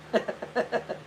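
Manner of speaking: breathy run of giggles; laugh. Real, not acted. Laughing after mimicking her greeting, amused giggle. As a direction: chuckle at your own silly impression.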